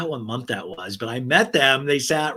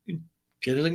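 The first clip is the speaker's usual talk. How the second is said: normal talking voice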